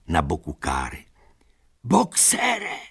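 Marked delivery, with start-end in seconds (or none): 1.8-2.8 very high, anguished call; desperate cry. A narrator's reading. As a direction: cry his name in despair. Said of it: "křičela zoufale Lupina".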